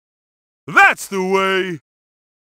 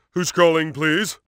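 normal talking voice

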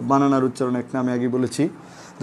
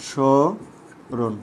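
স্মরণ very slowly, sho ... ron; syllable by syllable; 2 semitones lower, much slower than usual. Shown as a demonstration. Teacher breaking it down for students, স্মরণ in slow separated syllables. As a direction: stretch the first syllable of স্মরণ, then pause. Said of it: "একটু লক্ষ্য করো তো".

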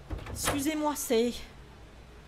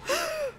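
sudden high intake of breath; sharp frightened gasp. Real, not acted. Startled by the door.

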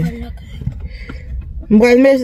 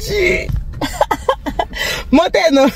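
laughing; 10 semitones higher, 4 dB quieter than usual. Real, not acted. Laughing with the toddler.

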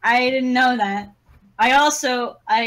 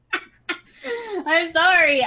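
giggling through the apology; laughing; 4 semitones higher, slower than usual. Real, not acted. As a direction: laugh while saying it.

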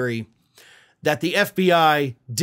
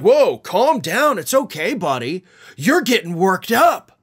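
slow, drawn-out "Whoa, calm down", louder, patronizing; mock condescending; 2 semitones higher, 3 dB louder, slower than usual. Host mocking Comer's patronizing words to a reporter. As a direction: talk down to him like he's overreacting. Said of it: "had the audacity to try to gaslight".

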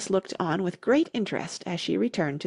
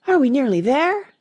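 higher, louder pleading question with a rise; panted question; 6 semitones higher, 6 dB louder than usual. A narrator's reading. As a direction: ask it high, a touch out of breath. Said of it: "Alice managed to pant out".

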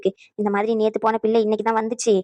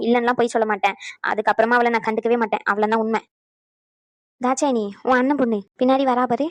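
ordinary talk

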